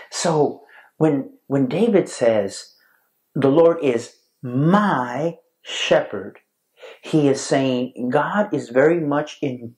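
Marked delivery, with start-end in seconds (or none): 3.3-6.4 slow quote with MY stretched out between pauses; stress on MY. Shown as a demonstration. Preacher explains what David says, stress on MY. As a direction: hold MY long, then shepherd.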